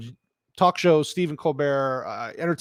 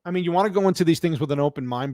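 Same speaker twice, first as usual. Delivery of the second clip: normal talking voice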